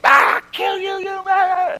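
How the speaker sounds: road-rage driver screaming through the window; mock furious yell. Angry driver yelling at him.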